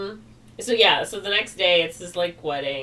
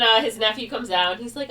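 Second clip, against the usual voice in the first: ordinary talk, nothing marked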